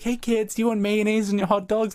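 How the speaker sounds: high mock-cheerful dad voice for kids; Jeremy Renner offering mayo. Jeremy Renner asking the kids about mayonnaise, high mock-cheerful voice. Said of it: "Jeremy Renner was like".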